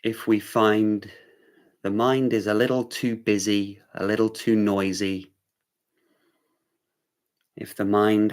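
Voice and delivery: unhurried meditation-teacher delivery; guided meditation. Real, not acted. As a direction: guide them gently into awareness, leaving room between phrases.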